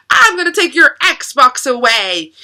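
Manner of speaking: loud, high-pitched mock threat; imitating angry screaming mom. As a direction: play a mother laying down punishment. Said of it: "she's screaming".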